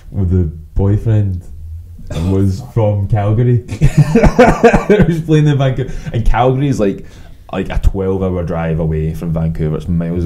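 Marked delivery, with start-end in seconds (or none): 3.7-5.9 laughing. Real, not acted. Laughing while telling story.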